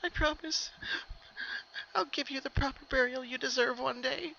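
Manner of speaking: high, tearful promise; a high voice fighting back sobs; pony vowing to her dead pet, high and teary; holding back sobs. A narrator's reading. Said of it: "She held back another sob".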